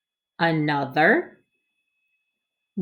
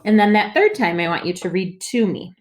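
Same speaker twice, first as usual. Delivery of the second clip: ordinary talk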